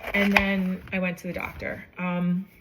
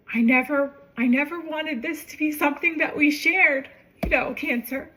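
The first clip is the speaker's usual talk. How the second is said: higher, emotion welling up in the voice; holding back tears while quoting herself, higher; voice breaking; 8 semitones higher than usual. Real, not acted. Telling her sister she has cancer.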